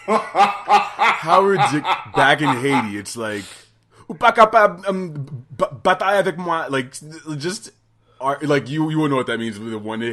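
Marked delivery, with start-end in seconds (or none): 4.1-6.6 loud, hammed-up Haitian accent; bad Haitian accent. Quoting arguing relatives back in Haiti, loud bad Haitian accent. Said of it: "that's a shitty Haitian accent".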